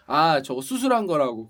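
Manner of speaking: dismissive imitation, dropped low. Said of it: "궁금하지도 않아요". (낮게 깔아 비꼬듯 따라하기)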